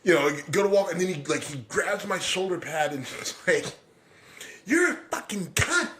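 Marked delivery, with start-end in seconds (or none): 4.6-5.9 much higher, spat-out furious insult; imitating the coach's vicious insult; coach snarling insult. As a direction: spit it at him like the raging coach. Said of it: "read me the riot act".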